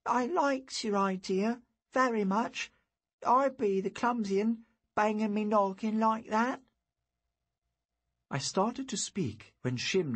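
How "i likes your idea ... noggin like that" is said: high, rustic lad's voice; hurt country boy. A narrator's reading. Injured boy takes the blame before Merlin, humble. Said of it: "he said weakly".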